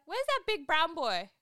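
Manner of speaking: loud, high mock British shout; bad UK accent. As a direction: call out in a hammed-up Manchester accent. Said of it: "my U K accent is not good".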